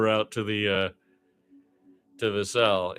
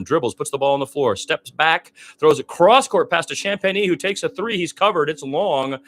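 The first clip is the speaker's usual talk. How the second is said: rising excitement; 8 semitones higher, 7 dB louder than usual. Real, not acted. Live play-by-play, building play.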